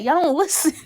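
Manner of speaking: high, exasperated scolding. Retold or imitated. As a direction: scold the characters for not listening. Exasperated at characters.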